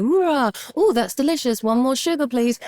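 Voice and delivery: hamming up a fussy order; mock demanding customer. A mom doing a fussy customer at the counter, high mock voice.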